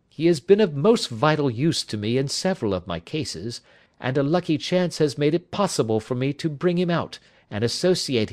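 a hint of a detective making introductions; lightly voiced Holmes.

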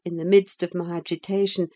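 plain reading voice

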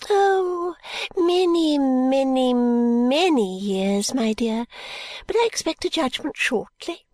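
put-on high, fluttery old lady, whispering bits; a rambling old woman clinging to hope; old Miss Flite. Narrator voices Miss Flite.

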